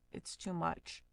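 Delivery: usual speaking voice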